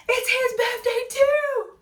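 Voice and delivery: high, loud, drawn-out holler; imitated shout. Someone in the next boat to hers, high imitated shout. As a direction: yell the news across from another boat.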